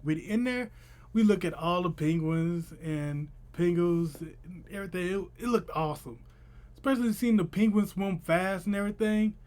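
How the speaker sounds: ordinary delivery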